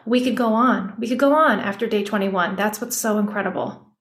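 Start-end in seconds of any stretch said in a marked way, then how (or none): none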